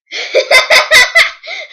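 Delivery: loud high-pitched laughter. Real, not acted. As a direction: burst out laughing. Right answer again.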